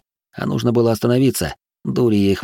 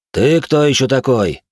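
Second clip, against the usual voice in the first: stern demand; 5 semitones higher, 4 dB louder than usual. Acted. Kselim confronts stranger.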